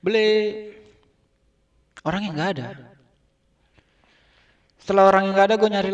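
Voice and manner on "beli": high call into the shop; calling out again. Retold or imitated. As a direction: sing out beli to summon the owner. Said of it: "gua teriak lagi".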